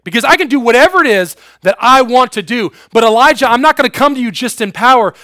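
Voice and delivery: loud, high, pressing sermon voice; preaching at full force. Acted. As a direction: build the story of God's power loudly.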